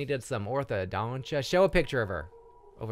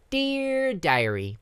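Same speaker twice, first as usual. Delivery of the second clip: put-on high slow child reading; childlike diary voice; 9 semitones higher, slower than usual. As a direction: read it like a little girl. Reads diary.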